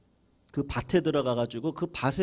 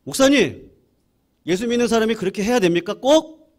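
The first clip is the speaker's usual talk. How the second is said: loud, high, fast indignant outburst; huffing angry protest; 8 semitones higher, 8 dB louder than usual. Retold or imitated. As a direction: fume at the pastor in disbelief. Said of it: "씩씩거리면서". (씩씩거리며 크고 높게 따지는 목소리)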